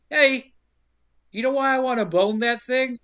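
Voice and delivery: loud, high-pitched opening, easing off; mock angry. Podcaster voicing angry Brody at the table, mock anger. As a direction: pick a fight, half in jest. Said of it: "he angrily is like".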